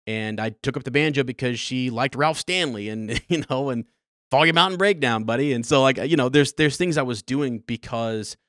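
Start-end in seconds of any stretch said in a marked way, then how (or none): 4.3-5.5 louder, higher, proudly naming the tune; a fond, loud shout-out to the tune; excited shout-out. Real, not acted. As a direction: call it out like a favourite song. Naming mom's bluegrass tune.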